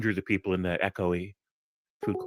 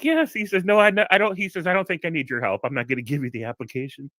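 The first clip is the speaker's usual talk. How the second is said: laughing boss's reply; 7 semitones higher than usual. Retold or imitated. Boss refuses the job, retold.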